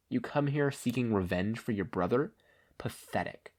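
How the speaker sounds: quiet, deadly calm warning; menacing villain. Acted. Stain mocking Ida.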